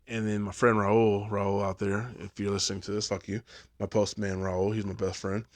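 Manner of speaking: ordinary talk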